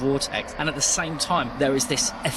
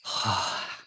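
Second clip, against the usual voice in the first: heavy sigh; 5 semitones lower, 5 dB quieter than usual. Acted. [name] sighs.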